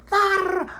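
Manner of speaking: loud, high "arr" of frustration; pissed-off growled shriek. Retold or imitated. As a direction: let out a wordless cry of rage, acted out. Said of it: "I was just pissed. I was like".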